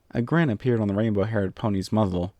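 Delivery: ordinary delivery, nothing marked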